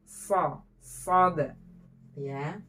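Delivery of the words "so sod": "So, sod" with a crisp, pressed s; long, sharp s on "So, sod"; clean sibilant. Shown as a demonstration. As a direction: teeth edge to edge, hiss the s of "So, sod" clearly. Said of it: "Nah, disejajarkan".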